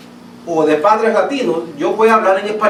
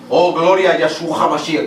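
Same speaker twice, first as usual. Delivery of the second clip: a lightly voiced, lower, put-on devout preacher; mock pious praise; 3 semitones lower than usual. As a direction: gently parody a showy worship cry. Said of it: "pero allí dicen". (imitación burlona de alabanza)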